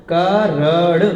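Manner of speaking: करण stretched out as "kaa-raan"; syllable-by-syllable correction. Shown as a demonstration. Teacher dictating the corrected word to students, drawn-out करण. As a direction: draw out each vowel of करण for dictation. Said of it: "कर्ण नहीं, करण".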